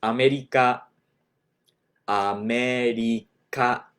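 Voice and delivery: America with tapped r, a-me-ri-ka; America said the Japanese way, a-me-ri-ka; アメリカ. Shown as a demonstration. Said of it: "So if you want to say the U S A".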